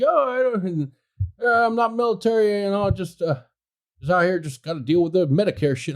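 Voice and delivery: a mild imitation of the apologetic old man; old neighbor voice. Voicing old neighbor again.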